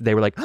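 sharp imitated gasp. Techs seeing the scan, mock gasp.

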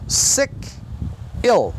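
sick and ill with tight, closed I vowels. Shown as a demonstration. Teacher to students, sick and ill with closed vowels. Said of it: "tudo fechadinho".

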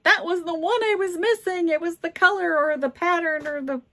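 imitating a thrilled collector, high-pitched; high, eager mock delight; excited buyer. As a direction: act out a buyer's excited cry. Acting out collectors finding missing piece.

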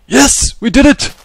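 high, loud whoop of victory; triumphant cheer. Real, not acted. Player cheering after finally catching the animal. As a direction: cheer like you just won.

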